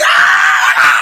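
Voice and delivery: long, loud hammed-up scream; mock crying shriek of acceptance; overjoyed bride's scream. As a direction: scream it out like she was just proposed to. Acting out the bride's reaction.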